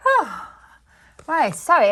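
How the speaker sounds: breathless from cleaning; puffing between words, winded; out of breath. Real, not acted. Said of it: "I'm getting quite out of breath".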